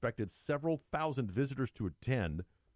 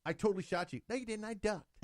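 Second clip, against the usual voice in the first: put-on playground kids; 11 semitones higher, faster than usual. Playground argument, acted kids.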